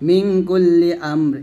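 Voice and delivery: slow recited verse, halting at the end; Quran recitation. Shown as a demonstration. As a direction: chant it and stop. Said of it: "এখানে থামবো". (থেমে থেমে তেলাওয়াত)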